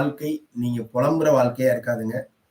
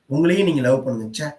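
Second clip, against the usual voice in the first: normal talking voice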